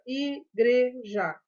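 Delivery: "igreja" slowly as i-gre-ja; slow, separated syllables in "igreja"; split into syllables. Shown as a demonstration. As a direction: sound out "igreja" one syllable at a time. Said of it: "o G com R".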